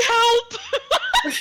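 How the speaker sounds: high frantic yelp; panicked cry for help. Acted. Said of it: "she's like".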